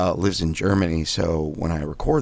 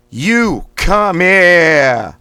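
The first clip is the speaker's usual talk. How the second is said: You come here slow, high and loud, here as Boston "hiah"; Boston accent; 10 semitones higher, 10 dB louder, much slower than usual. Shown as a demonstration. Coach leading Boston warm-up, slow loud Boston You come here. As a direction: stretch it out in Boston with a dropped R. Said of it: "It's gonna be in a Boston accent".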